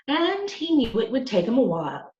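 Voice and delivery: ordinary delivery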